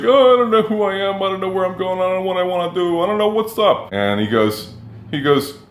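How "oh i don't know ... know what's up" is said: much higher, hammed-up moping voice of his younger self; mock self-pity. Telling friends at a coffee shop how lost he felt, in a high mock-mopey voice. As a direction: play up the lost young man, high and sorry for himself. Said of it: "and I was like".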